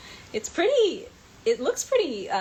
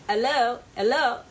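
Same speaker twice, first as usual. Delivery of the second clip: louder, higher mock Cockney hello; Cockney accent; 5 semitones higher, 7 dB louder than usual. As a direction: imitate a put-on British Cockney greeting. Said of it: "like a Cockney accent".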